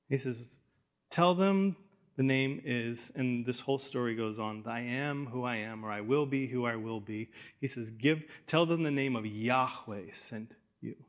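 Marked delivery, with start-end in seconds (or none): 1.1-11.0 low, soft, steady answer; God answering, low and quiet; calm voice of God. Acted. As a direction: speak softly as God.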